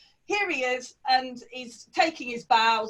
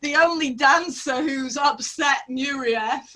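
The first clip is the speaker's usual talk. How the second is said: mock dismay; 4 semitones higher, 4 dB louder than usual. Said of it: "I was like".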